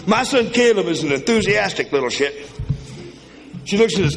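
ordinary delivery

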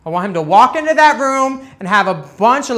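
usual speaking voice

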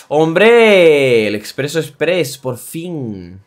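loud, high welcome; excited greeting. Real, not acted. Encuentra al personaje por fin. (saludo eufórico)

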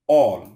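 অল said loud with an open "aw" vowel; vowel-like A sound. Shown as a demonstration. Teacher giving an example for A, vowel-like sound. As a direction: punch out অল as a model word. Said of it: "স্বরের মতো উচ্চারণ হচ্ছে এখানে".

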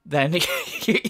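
ordinary talk

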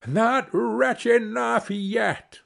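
very slow, high taunt; taunting sparrow. Acted. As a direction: drag out each word, pitched high. Sparrow taunts the carter again.

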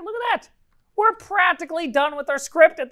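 very high-pitched, gleeful boast; excited triumph. Real, not acted. Coder shows off nearly finished script, excited triumph.

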